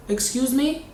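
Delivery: affronted retort. Retold or imitated. Tenant answering the landlady who ordered her out, affronted. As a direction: ask it as if taken aback.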